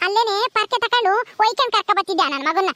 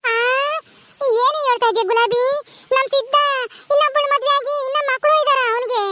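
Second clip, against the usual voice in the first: put-on old granny gasping in disbelief, pitched higher; a deaf grandmother hearing her son remarried, aghast; high, stunned old woman's voice; shocked old granny; 6 semitones higher than usual.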